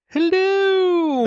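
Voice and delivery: high sing-song hello, held long; long high-pitched cooed greeting; drawn-out greeting. Acted. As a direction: call out a very high, stretched hello. Playful drawn-out greeting in read letter.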